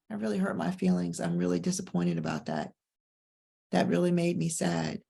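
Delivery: soft, low admission of disappointment; quietly hurt. Retold or imitated. Speaker owning feelings after a workout, quietly hurt. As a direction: quietly own the hurt, mildly sad.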